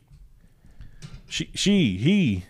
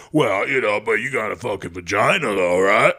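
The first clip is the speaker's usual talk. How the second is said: mock arguing; 3 semitones lower than usual. Voices a pointless argument with stranger.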